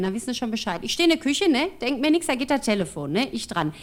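ordinary talk

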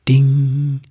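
bright ringing bell sound; sung bell chime; bell ding. Acted. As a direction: ring it out like a tiny silver bell. Silver bell rings in story.

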